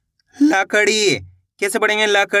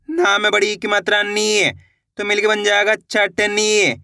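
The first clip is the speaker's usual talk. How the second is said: ordinary delivery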